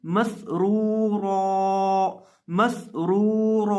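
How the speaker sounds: slow recitation of मसरूरा ending on a long आ; pausal form recited. Shown as a demonstration. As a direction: recite मसरूरा stopping on the alif. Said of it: "अब इसको वक्फ के अंदर देखें". (वक्फ में मसरूरा धीमी तिलावत)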